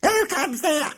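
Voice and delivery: very high, strange sentry voice; sharp ferret challenge. Acted. Ferret sentry challenging Toad at the gate, sharp. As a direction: bark the challenge like a guard. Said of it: "said the ferret sharply".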